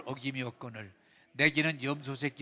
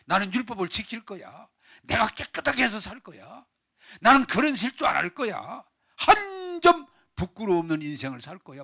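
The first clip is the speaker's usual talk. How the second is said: defiant self-assertion; 6 semitones higher, 7 dB louder than usual. Acted. Boasts of keeping the law.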